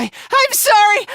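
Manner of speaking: high, drawn-out apology; frantic apology. Acted. Fighter apologising for talking at all, frantic. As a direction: wail the apology, high and slow.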